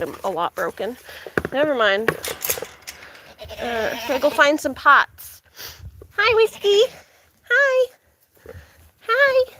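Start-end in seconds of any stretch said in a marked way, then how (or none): 6.2-9.5 very high-pitched little hello; high pet greeting. Real, not acted. Owner greets the animals while hunting for pots, high-pitched.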